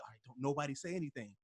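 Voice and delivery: soft, fast hushed warning. Retold or imitated. Parent hushing everyone once the child nods off, softly. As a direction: hush the room so the kid stays asleep. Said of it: "you're like".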